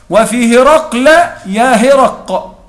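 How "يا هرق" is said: "يا هرق" slowly, with the qaf left still; sukun on qaf. Shown as a demonstration. Teacher to students, slowly demonstrating the still qaf in "يا هرق". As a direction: stop dead on the qaf of "يا هرق". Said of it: "ببقاء سكون القاف".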